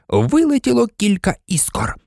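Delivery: usual speaking voice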